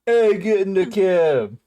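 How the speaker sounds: slow, high, bad Boston; attempted Boston accent. Friends ask for Boston, slow attempted Boston accent. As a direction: stretch it out in a hammy Boston accent. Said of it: "you going for Boston now?".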